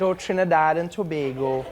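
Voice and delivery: easy Trinidadian sing-song; Trinidadian accent. Retold or imitated. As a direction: imitate a relaxed Trinidadian accent. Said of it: "I love how you guys say that".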